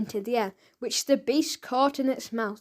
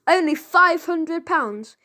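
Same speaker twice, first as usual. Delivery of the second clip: higher, louder and slow, mocking; scornful echo; 5 semitones higher, 5 dB louder, slower than usual. A narrator's reading. Crime boss mocking the debtor's excuse, higher, louder, slow. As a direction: throw his words back at him.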